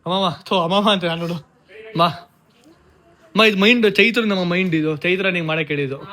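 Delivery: ordinary talk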